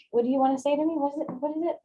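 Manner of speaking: mock an eager lover pressing; eager partner. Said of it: "And he's like".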